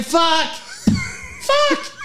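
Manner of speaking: high, shouted swear; exasperated curse. Retold or imitated. Screener cursing at wrong name, high exasperated shout. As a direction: blurt out in frustration. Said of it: "screener swearing".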